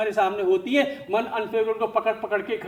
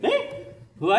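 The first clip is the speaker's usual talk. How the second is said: ordinary talk, nothing marked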